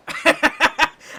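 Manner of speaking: loud, high burst of laughter. Acted. Octopus laughing at its own jab at the human, loud.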